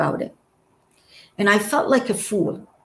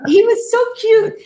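much higher, laughing as she gushes; giggly gushing; 13 semitones higher, 4 dB louder, faster than usual. Real, not acted. Wife fondly recalling her husband's joke, giggling high.

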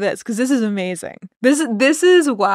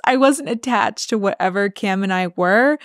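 usual speaking voice

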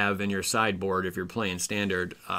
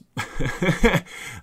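short high laugh; 7 semitones higher, 4 dB louder than usual. Real, not acted. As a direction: burst out laughing. Laugh after grade.

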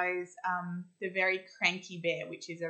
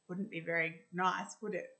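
ordinary delivery

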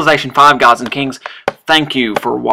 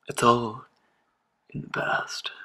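softer, trailing into a bare whisper; fading whisper; 11 dB quieter than usual. Acted. Player muses on past relations, fading to a whisper. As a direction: let it die away, breath only at the end. Fading mock-dramatic repeat of phrase.

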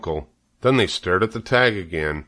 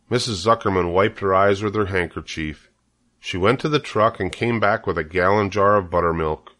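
plain reading voice

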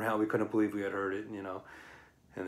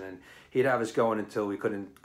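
normal talking voice

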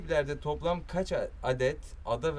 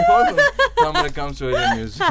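high, loud laughing words; squealing with laughter while talking; 13 dB louder than usual. Real, not acted. Laughing at the vague answer. (kahkahalar arasında)

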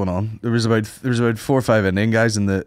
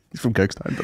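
normal talking voice

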